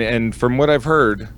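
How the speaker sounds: ordinary talk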